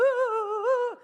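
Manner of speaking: high mock wail; comic imitation of nonstop bawling; imitated crying. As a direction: wail a hammed-up, high-pitched sob. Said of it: "this woman is just crying".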